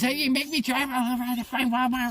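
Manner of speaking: high put-on complaining lady; imitating her griping about the Walmart trip; mock whiny woman. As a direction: whine it like a nagging passenger. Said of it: "She goes".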